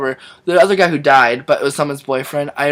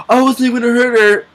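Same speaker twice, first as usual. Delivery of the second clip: mock protest; 7 semitones higher, 4 dB louder than usual. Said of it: "He's like".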